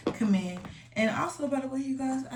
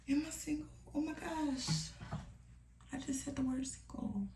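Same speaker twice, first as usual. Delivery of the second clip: embarrassed whisper; 6 semitones higher, 10 dB quieter than usual. Real, not acted. Catches herself saying single.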